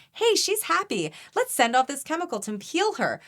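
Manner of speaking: high, bright mock voice of the body cheering. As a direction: squeak it up like an inner voice. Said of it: "it sends a signal to my body".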